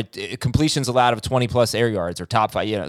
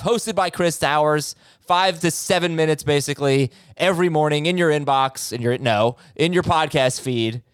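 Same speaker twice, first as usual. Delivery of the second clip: ad read; 5 semitones higher than usual. Acted. Promo read for the show.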